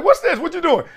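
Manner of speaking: high, curious questions; startled questions about the music; surprised. Retold or imitated. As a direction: ask like you just caught something good. Jumping into the friend's car.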